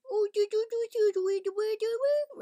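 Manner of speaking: squeaky, very high babble; mock baby-talk gibberish, high and loud. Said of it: "in a baby voice".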